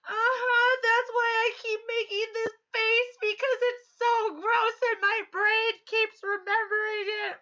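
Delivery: put-on high, loud child explaining; hammed-up little girl. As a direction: play the earnest small girl. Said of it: "And she goes".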